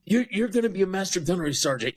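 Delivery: mock incredulous sergeant major. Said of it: "He's like".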